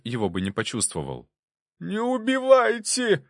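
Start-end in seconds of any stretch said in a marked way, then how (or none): 1.8-3.2 high, slow, frantic squeal; terrified squeal. A narrator's reading. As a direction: shriek it, begging for life. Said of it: "заверещал толстый мужичок".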